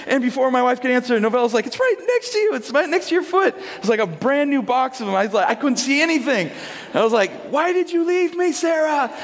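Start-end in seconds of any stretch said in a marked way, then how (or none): 1.7-3.6 put-on very high-pitched child voice; exasperated daughter. Daughter showing dad the diapers, imitated high exasperation. 7.5-9.1 very high, hammed-up wail; mock despair. Overwhelmed dad to absent wife, mock-despairing wail.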